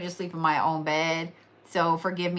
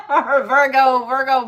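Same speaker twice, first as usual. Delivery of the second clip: laughing out loud, higher; 4 semitones higher, 8 dB louder than usual. Real, not acted. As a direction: crack up mid-word.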